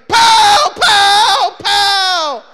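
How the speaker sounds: loud high "pow, pow, pow" blasts; shouted gun noises. Retold or imitated. Eric firing a toy gun at the bus, shouting gunshots. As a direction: bark out fake gunshots like a kid playing war.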